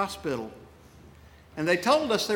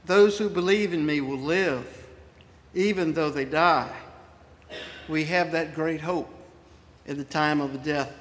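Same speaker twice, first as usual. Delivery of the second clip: ordinary talk, nothing marked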